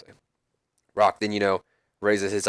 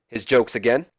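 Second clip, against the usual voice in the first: plain reading voice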